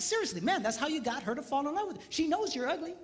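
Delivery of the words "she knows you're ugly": ordinary delivery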